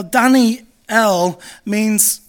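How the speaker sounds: Daniel drawn out as "DAN-ee-EL", loud; emphatic, syllable-by-syllable Daniel; Hebrew pronunciation. Acted. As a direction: stretch Daniel slowly into Dan-ee-EL. Sounding out the Hebrew name.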